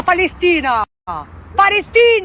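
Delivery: proud, insistent one-word chant; emphatic proclamation. Shown as a demonstration. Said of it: "Voilà, comme ça".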